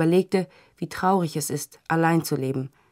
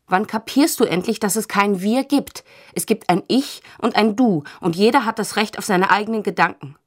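louder, higher, exasperated lecture; exasperated outburst; 4 semitones higher, 6 dB louder than usual. A narrator's reading. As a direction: hammer home that there is no we. Heated quarrel between partners. (genervt und laut, eindringlich)

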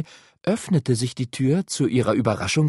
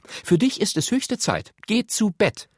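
much higher, bossy big-sister voice; raised, insistent pitch, playing mother; 11 semitones higher than usual. A narrator's reading. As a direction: order a younger brother about. Sister orders brother to bed.

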